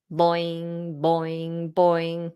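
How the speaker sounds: playful spring sound, boing; cartoonish bungee bounce; imitated bouncing noise.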